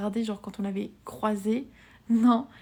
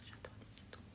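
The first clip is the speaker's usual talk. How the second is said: whispered; 26 dB quieter, faster than usual. Pretend. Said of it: "repeats it whispered".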